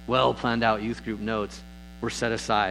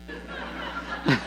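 high breathy imitated giggle from the back row; 6 dB quieter than usual. Wife teasing husband from the back row, high giggle. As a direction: mimic a wife's teasing little laugh.

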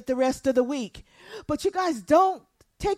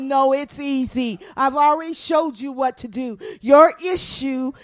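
God correcting; 8 dB louder than usual. Acted. God answers the complainer, loud firm correction. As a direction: answer firmly, landing hard on each phrase. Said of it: "And God said".

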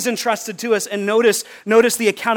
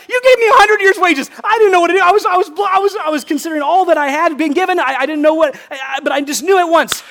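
much higher and louder, breathless with glee; ecstatic servant; 9 semitones higher, 6 dB louder, faster than usual. Acted. Servant to his master, overjoyed at the return. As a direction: gush it out like you can't believe your luck. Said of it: "He's ecstatic".

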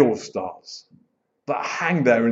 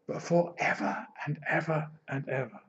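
hushed awe; 12 dB quieter than usual. Real, not acted. Awed climax of eternity.

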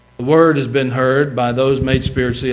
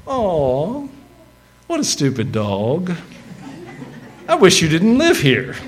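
sweet pet talk; 4 semitones higher than usual. Shown as a demonstration. Preacher to a dog, mean words in a cooing voice. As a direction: coo it like doting baby talk, pitch swooping up. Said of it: "And then you can say to that dog".

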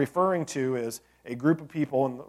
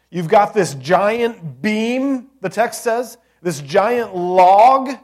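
slow, loud and higher, stretching giant; big preaching emphasis; 6 semitones higher, 10 dB louder, much slower than usual. Acted. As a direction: paint the huge log with your voice. Preaching the giant beam image.

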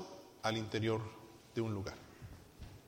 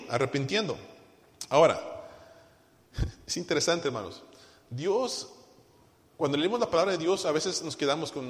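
usual speaking voice

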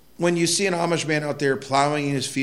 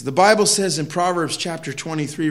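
ordinary talk

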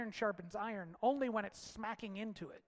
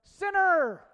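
much higher and louder, called out far; downrange yell; 10 semitones higher, 15 dB louder than usual. Retold or imitated. Man at archery target yelling a missed shot, a loud shout. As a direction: shout it across the range. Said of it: "they yelled".

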